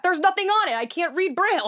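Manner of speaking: high, loud, indignant self-defense; incredulous protest. Retold or imitated. Test-taker justifying picking up the dropped book, incredulous protest. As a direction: protest it loudly, pitched right up.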